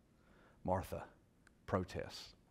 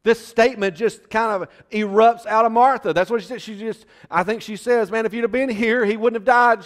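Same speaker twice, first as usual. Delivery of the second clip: high, loud impassioned preaching; full-force preaching; 12 semitones higher, 16 dB louder than usual. Acted. Preacher retells Martha's faith to the congregation, full force. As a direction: preach it to the rafters.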